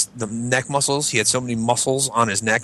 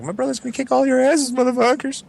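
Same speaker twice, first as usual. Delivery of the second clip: high yelled threat; high retold threat at the crew; mock panicked yell.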